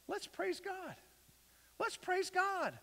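soft, high-pitched little voice; light, high invitation. Acted. Preacher urging praise instead of complaint, soft high voice. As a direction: lift it high and keep it small.

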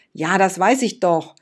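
put-on loud, huffy child's retort; stamping-foot impatience, loud; snorting like a little dragon. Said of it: "schnaubte wie ein kleiner Drache".

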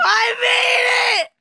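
high, drawn-out cry of victory; triumphant shout. Real, not acted. Player announces finally making the climb, triumphant high shout. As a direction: stretch it out jubilantly. Celebrates reaching the top.

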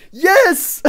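victory cheer. Real, not acted. Won the game.

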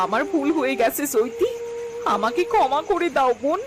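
high, rising plea for forgiveness; pleading apology. Acted. Sister-in-law begging Chaiti for forgiveness, tearful pleading. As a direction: beg her sister-in-law to forgive you.

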